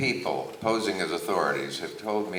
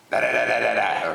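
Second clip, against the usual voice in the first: loud, higher string of da-das; imitated da-da outburst; 6 semitones higher, 5 dB louder, faster than usual. Interviewer imitating Harpo turning to the camera, loud da-da noise. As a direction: rattle off da-das at raised pitch. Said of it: "turns back to the camera and goes".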